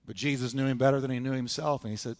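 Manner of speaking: ordinary talk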